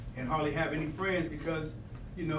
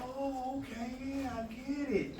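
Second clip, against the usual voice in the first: soft aha; 8 semitones higher, 6 dB quieter, slower than usual. Acted. Acting the learner understanding.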